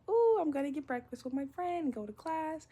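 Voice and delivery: high, sing-song morning voice; acted-out breezy cheer, pitched high; carefree chirp. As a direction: chirp it like an easy morning plan. Acting out her carefree morning.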